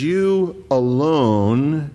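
"You alone" drawn out very slowly, YOU weighted; emphatic you alone. Shown as a demonstration. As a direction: lean hard on "You alone". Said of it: "it's emphatic".

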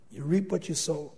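normal talking voice

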